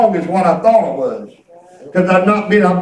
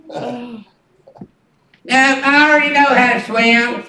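put-on funny six-year-old voice; 2 semitones higher than usual. Granddaughter answering the swim question, funny child voice. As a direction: imitate a cocky small child. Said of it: "but I make it funny".

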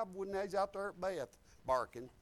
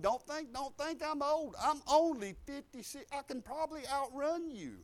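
raised, emphatic preacher's delivery; forceful preaching; 7 semitones higher, 8 dB louder than usual. Acted. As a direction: challenge the young from the pulpit, pitch climbing. Preaches, challenges the young.

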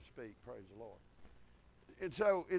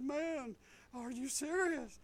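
soft, higher voice of a man moved; hushed, incredulous question; awed disbelief; 4 semitones higher, 7 dB quieter, slower than usual. Retold or imitated. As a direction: imitate someone quietly stunned. Retold man moved to tears asks.

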